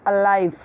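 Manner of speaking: "अलाइव" with a weak first vowel and falling end; correct pronunciation of alive. Shown as a demonstration. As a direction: say "अलाइव" as uh-LIVE. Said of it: "तो इसे अलाइव नहीं बोलना है".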